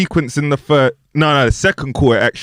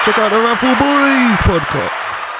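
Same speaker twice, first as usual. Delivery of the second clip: hype announcer; 7 semitones higher than usual. Acted. Hype-man introduction of the producer.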